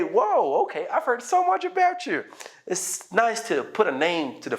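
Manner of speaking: excited greeting. Shown as a demonstration. Finally meeting the person you heard so much about, excitedly. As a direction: pretend to be thrilled at last to meet him. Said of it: "If you want to exaggerate it".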